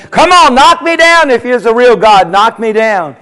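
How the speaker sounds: acted taunt, starting high and easing down; cocky professor egging on an opponent; goading taunt. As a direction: mockingly dare God to act. Professor taunts God, pacing the aisle.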